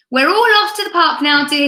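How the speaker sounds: a cheerful husband's loud announcement; booming announcement. Acted. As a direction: burst in and announce. Husband bursting in loudly.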